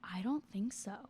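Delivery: breathy whispered aside, no voice at all. Real, not acted. Unsure vlogger admits to her camera in a whisper. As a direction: whisper it to yourself, doubtful. Doubting aside after a long pause.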